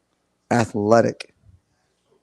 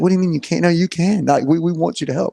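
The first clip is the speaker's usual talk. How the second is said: higher, louder pleading objection; insistent protest; 5 semitones higher, 4 dB louder than usual. Retold or imitated. Coach retells urging a dad to help, insistent. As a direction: refuse to take no for an answer.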